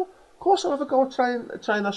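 high, eager mock keenness; squeaky mock eagerness; eager volunteer. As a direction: overkeen fan jumping at the chance. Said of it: "would just be like".

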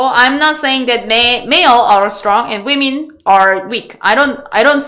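normal talking voice

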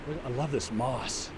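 ordinary talk

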